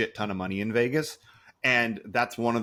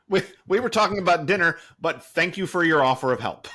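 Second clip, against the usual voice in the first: raised, louder voice setting the cabbie straight; acted polite refusal; 8 semitones higher, 5 dB louder than usual. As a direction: turn down the offer emphatically, pitched up.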